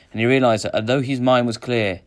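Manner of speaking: ordinary delivery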